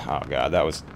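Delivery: ordinary talk, nothing marked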